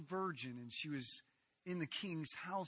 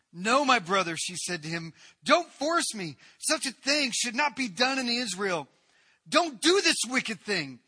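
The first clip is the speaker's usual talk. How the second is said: loud, high, anguished begging, much higher and louder; desperate pleading; 8 semitones higher, 16 dB louder than usual. Acted. Tamar begs her half-brother not to force her, frantic pleading. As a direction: beg him to stop with everything you have.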